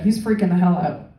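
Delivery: ordinary talk, nothing marked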